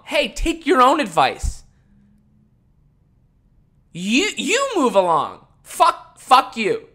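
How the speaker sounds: high, loud, slow clapback; shouting fuck you at the screen; angry retort. Real, not acted. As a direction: throw it back in their face. Snapping back at commenters.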